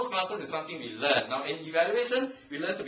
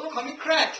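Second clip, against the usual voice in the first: playful mock protest, higher; mock angry Craig; 10 semitones higher, 5 dB louder than usual. Craig jokingly objects to his name, mock anger. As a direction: pretend to be offended, laughing. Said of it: "If I were Craig, I'd be very angry".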